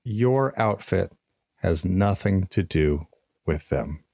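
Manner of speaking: slow, deliberate statement. Real, not acted. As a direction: spell it out slowly, phrase by phrase. Emphatic plain statement to listeners.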